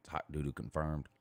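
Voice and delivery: normal talking voice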